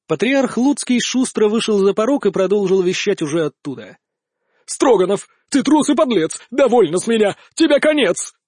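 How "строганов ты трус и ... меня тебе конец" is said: angry. Acted. Patriarch yelling from doorway.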